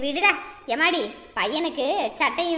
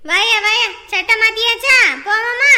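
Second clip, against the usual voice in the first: loud coaxing; 5 semitones higher, 9 dB louder than usual. Real, not acted. Grandmother coaxing crying boy. (குழந்தையை உரக்க உற்சாகமாக அழைத்தல்)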